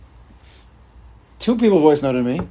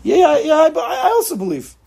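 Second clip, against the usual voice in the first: much higher, louder, hurried mock nodding along; mock half-hearted belief; 11 semitones higher, 3 dB louder than usual. Mocking people who nod along to belief, half-hearted. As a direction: brush it off like a careless yes-man. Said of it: "they nod their head".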